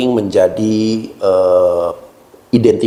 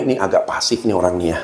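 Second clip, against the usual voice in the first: dismissive judgement; 5 semitones lower, 5 dB quieter, faster than usual. Retold or imitated. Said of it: "bisa juga orang menilai".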